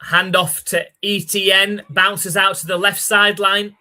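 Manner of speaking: lively sportscaster calling the snap; play-by-play call. Acted. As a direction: call the play like a TV announcer, building as the ball flies. Live play-by-play calling the game.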